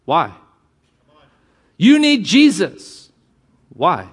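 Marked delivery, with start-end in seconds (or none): 1.8-3.1 higher, slow and louder, pushing hard; blunt preachy pitch. Retold or imitated. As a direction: hammer it at a stranger like a pushy street preacher. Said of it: "We go up to people and say".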